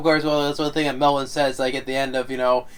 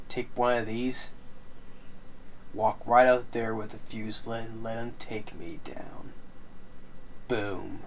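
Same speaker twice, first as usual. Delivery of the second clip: grim quiet resolve; 3 semitones lower, 7 dB quieter, slower than usual. Retold or imitated. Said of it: "suggesting it's like".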